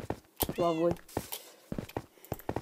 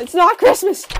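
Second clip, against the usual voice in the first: loud protest; 9 semitones higher, 8 dB louder than usual. Real, not acted. Refusing friend's present again.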